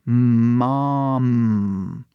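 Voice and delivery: "Mom" stretched, ending on a held hum; closed-lip final M. Shown as a demonstration. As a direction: draw out "Mom", closing the lips on M. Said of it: "Tem que fechar".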